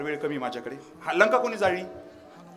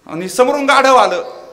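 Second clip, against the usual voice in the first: ordinary delivery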